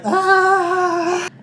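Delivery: high, loud comic scream; mock panicked scream. Crowd fleeing the atrium, mock scream.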